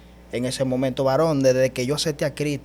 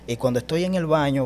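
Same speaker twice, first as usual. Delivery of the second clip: ordinary talk, nothing marked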